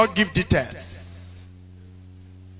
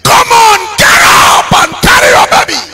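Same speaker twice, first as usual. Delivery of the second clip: high, loud pleading yell; desperate shout at the dead; 11 semitones higher, 11 dB louder than usual. Retold or imitated. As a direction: scream at the body to get up. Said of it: "slapped the dead body ... and said".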